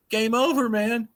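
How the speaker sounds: high excited exclamation; game over exclaim. Pretend. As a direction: shout it out like a gamer. Doomsday clock hits midnight exclamation.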